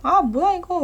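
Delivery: a sudden "what is this?"; surprised. Retold or imitated. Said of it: "이러면서".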